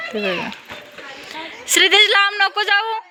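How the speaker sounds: mother calling her son back, high and carrying. Real, not acted. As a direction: pitch it high so he hears from far away. Calling to child far away.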